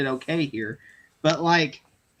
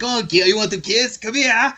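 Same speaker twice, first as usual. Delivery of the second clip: louder, lightly imitated celebrity; mock eager come-on to a fan; raised, loud imitation of a glad-handing star; retold star's offer; 4 semitones higher, 5 dB louder than usual. Retold star offering kisses to fans.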